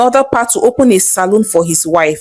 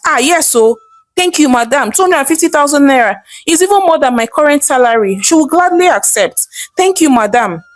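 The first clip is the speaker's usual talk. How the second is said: higher, fast, eager thanks; grateful guard thrilled by the salary; excited; 5 semitones higher than usual. Acted. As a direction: gush with delight at the offer. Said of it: "Iben replied excitedly".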